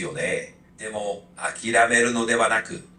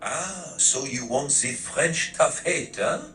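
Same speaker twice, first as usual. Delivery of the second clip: put-on tough critic with a French accent. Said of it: "in a French accent".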